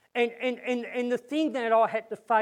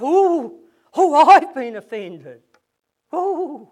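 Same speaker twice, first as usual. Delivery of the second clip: hammed-up wounded ooh, high and much louder; mock umbrage; 4 semitones higher, 9 dB louder, slower than usual. Preacher mocks a churchgoer taking umbrage, hammed-up and huffy. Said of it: "Stop taking umbrage".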